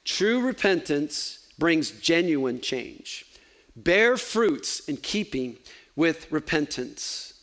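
slowed down, each word set apart; a preacher driving home the main point, slowly; slow, emphatic. Real, not acted. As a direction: lean on "Bear fruits in keeping with repentance" slowly. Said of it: "You remember the big picture".

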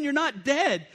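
normal talking voice

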